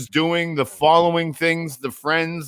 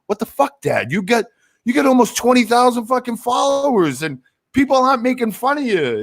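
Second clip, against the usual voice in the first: imitating son; 7 semitones higher than usual. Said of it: "he was like".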